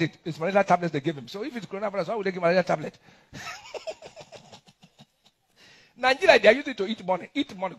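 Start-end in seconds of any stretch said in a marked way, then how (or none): 3.3-4.5 high breathy chuckle; a quick laugh at the absurdity; amused laugh. Real, not acted. Mocking the malaria tablets story, high amused chuckle.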